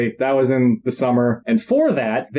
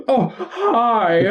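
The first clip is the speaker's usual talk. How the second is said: laughing replay; 8 semitones higher than usual. Retold or imitated. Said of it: "replaying his startled greeting".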